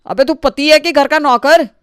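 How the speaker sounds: high, loud taunt; taunting jeer. Acted. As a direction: jeer at him. Taunting brother.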